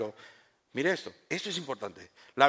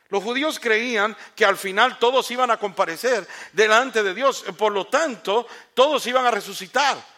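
louder, higher preaching with a laugh breaking in; fervent preaching; 8 semitones higher, 7 dB louder than usual. Acted. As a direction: press the point home to the people. Preacher explaining with rising force.

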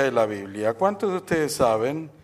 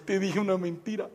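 higher, softer, laughing through it; sheepish confession; 5 semitones higher, 5 dB quieter than usual. Acted. Juanito to dad, confessing the lie while laughing softly.

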